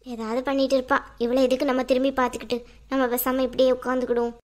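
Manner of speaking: low-pitched, grumbling inner monologue; irritated woman. Acted. Irritated character's private grumbling.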